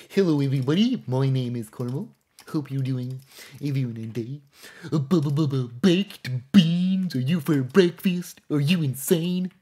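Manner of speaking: high, loud, goofy cartoon moose; dopey cartoon moose, pushed up high; Bullwinkle moose voice. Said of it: "Carmel's voice is very much like a Bullwinkle moose voice".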